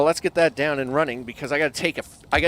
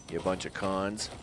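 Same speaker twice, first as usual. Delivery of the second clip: softer, lower grumbled insult; muttered jab; 7 semitones lower, 10 dB quieter than usual. Real, not acted. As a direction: mutter it at the wolves. Sulky insult to wolves.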